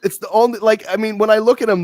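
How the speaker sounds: high, loud, laughter-filled explanation; laughing. Real, not acted. Explaining the comparison to shocked co-host, still laughing. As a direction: keep explaining while still cracking up.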